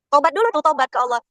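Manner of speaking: usual speaking voice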